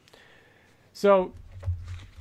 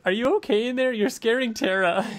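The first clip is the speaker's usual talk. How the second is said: mock Eve; 7 semitones higher than usual. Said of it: "Eve, like".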